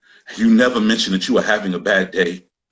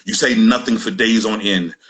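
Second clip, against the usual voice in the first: normal talking voice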